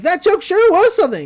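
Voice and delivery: high and loud mock old-timer; old man heckler. Recapper voicing a balcony heckler, high mock grump. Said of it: "he's like".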